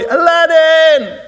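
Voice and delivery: high, long-drawn shout for a hero; desperate shout. Acted. Kid shouts to his hero on the passing float, desperate shout. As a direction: yell it out, stretching the name. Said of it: "And so he shouts".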